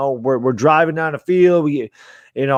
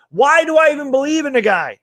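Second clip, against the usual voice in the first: exasperated; 9 semitones higher than usual. Real, not acted. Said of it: "Jesus Christ".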